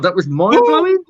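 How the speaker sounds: a hammed-up Brummy twang on mind-blowing; Brummy accent. Host imitating his own Brummy review. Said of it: "he said it in a Brummy accent".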